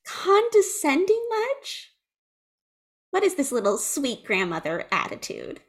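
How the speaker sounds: mocking retort. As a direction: throw it back mockingly. Acted retort to condescending witch.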